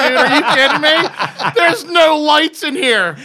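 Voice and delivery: loud, high comic rant; hammed-up exasperated tirade; mock outrage. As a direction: yell in playful indignation, half laughing. Mock rant about dark studio.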